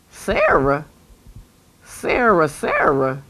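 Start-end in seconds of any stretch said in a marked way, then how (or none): none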